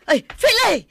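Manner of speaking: high, loud, frantic calls across the house; panicked shout for help. Acted. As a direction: scream for your husband to come now. (napigsa nga ikkis a dumawat iti tulong)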